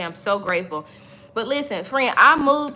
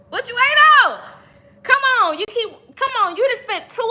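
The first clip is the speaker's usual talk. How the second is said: much higher and louder, pushing hard; loud urging; 11 semitones higher, 3 dB louder than usual. Real, not acted. Pushing viewers to finally start, in a loud urging voice. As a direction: shove them off the fence.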